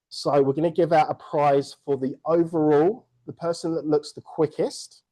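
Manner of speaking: normal talking voice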